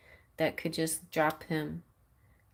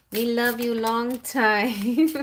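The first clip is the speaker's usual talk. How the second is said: higher, louder, slow teasing put-on voice; silly mock line; 5 semitones higher, 5 dB louder, slower than usual. Mocking the spirit's promise, silly put-on voice. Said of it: "Sorry. I'm being silly".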